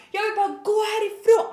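high, loud pleading wail; angry, near tears. Retold or imitated. Customer begging to leave salon, tearful shout. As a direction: imitate someone desperate and hysterical. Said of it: "nästan gråta".

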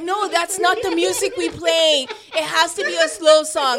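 DJ correcting caller. Retold or imitated. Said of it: "It's like".

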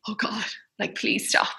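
breathless unvoiced whisper of disgust; labouring woman near a smoker, whispering; whispered plea. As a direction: breathe it out softly, pleading. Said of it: "I was like".

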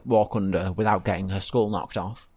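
usual speaking voice

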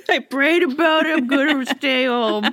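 high put-on homebody voice; mock protest. Podcaster plays astronomer excusing himself from conference, mock protest.

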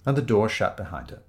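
ordinary delivery, nothing marked

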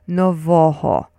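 "Novoho" slowly, no-VO-ho; middle-syllable stress. Shown as a demonstration. Teacher has listeners join in, "Novoho" stressed slowly as no-VO-ho. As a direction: lead a slow repeat of "Novoho", leaning on VO. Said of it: "So say it with me".

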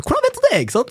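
sudden high, stunned question; baffled surprise. Retold or imitated. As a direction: shoot up in disbelief. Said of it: "Jeg sier".

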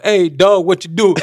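high, loud, laughing street-talk voice; mock hip-hop greeting to a dog. Friend teasing the host about meeting a chihuahua, high loud mock street talk. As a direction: hype up a tiny chihuahua like a homie. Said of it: "så du bare".

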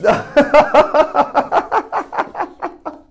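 loud, high burst of laughter; real high-pitched belly laugh. Real, not acted. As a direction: laugh long and hard at the punchline. Laughing at the punchline.

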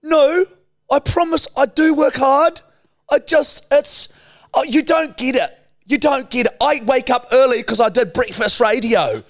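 defensive Kiwi influencer insisting she works hard; New Zealand accent. Acted. An influencer insists to critics she works hard, high whiny New Zealand accent. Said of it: "influencer from New Zealand".

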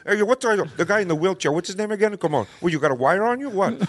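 suspicious movie gangster voice; put-on tough guy, prodding; Al Pacino. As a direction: needle them like a wary mobster. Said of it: "I'll be Al Pacino".

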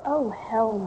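normal talking voice

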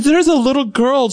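high-pitched imitation of a panicking mother; frantic mom. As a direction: report it in hammed-up distress. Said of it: "She's crying".

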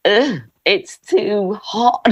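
high, very slow mock whinge; drawn-out, high-pitched disgusted complaint; complaining old lady. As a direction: groan it out like an overheated old lady. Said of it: "So I turned into that old lady".

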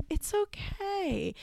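a light mock Eastern European accent, slow and high; soft accented imitation. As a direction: coo it like the kind parenting lady with her unplaceable accent. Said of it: "She had an accent that I couldn't place".

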